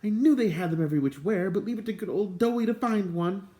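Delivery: high, loud, cheerful praise; bubbly high voice praising his friend; delighted high exclamation; excited. A narrator's reading. Islandly keeps excited cry.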